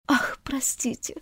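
hushed, embarrassed whisper; whispered ah of sudden embarrassment. Acted. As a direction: breathe the apology, caught out. Alice catches her blunder.

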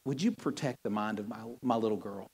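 soft, hushed, earnest prayer; sincere prayer. Shown as a demonstration. Pastor shows the congregation a better prayer, softly and sincerely. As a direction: pray it gently, meaning every word. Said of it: "Why don't you say something like this?".

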